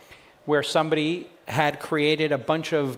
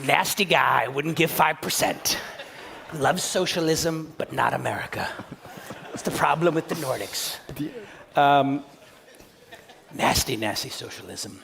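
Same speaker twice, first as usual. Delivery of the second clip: Donald Trump impression. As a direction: sneer nasty like Trump at a rally. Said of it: "I can do a Donald Trump impersonation".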